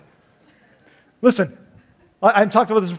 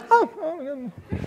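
mock indifference; 8 semitones higher, 6 dB quieter, slower than usual. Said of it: "Everybody's like".

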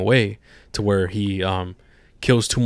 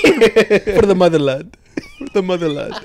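laughing mock German patriot; German accent; 8 semitones higher, 5 dB louder than usual. Echoing a friend's joke, German accent. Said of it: "but with that accent".

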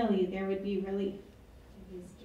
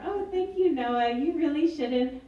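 gushing God; 7 semitones higher, 8 dB louder than usual. Retold or imitated. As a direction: swoon over the gift, drawing out the thanks. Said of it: "God sitting on her cloud being like".

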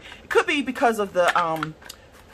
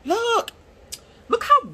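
high squeaky look with a laugh; giggly high look; 14 semitones higher than usual. Real, not acted. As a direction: show it off giggling. Laughing at blue.

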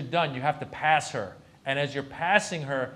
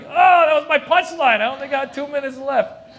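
high, very loud wail of protest; exasperated yell; 13 semitones higher, 14 dB louder than usual. Acted. Comic drowned out by espresso machine, yelling in protest. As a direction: yell it over the noise, pitched way up.

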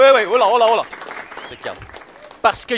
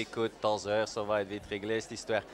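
low and much softer, a menacing murmur; low menacing threat; 12 semitones lower, 14 dB quieter than usual. Acted. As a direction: lean in and threaten him quietly. Cop's low threatening catchphrase.

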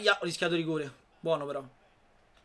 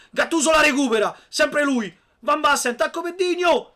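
excited commentary; 9 semitones higher, 8 dB louder than usual. Real, not acted. Building attack in match.

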